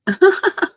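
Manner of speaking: sudden high burst of laughter. Real, not acted. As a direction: laugh out at the mix-up. Surprised laugh at misreading.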